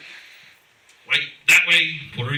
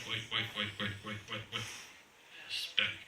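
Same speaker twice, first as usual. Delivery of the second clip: softer, lower counting under the breath; muttered scan; 8 semitones lower, 17 dB quieter than usual. Retold or imitated. Scanning the crowded room, muttering softly under the breath. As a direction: count them off quietly to yourself. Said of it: "I'm like".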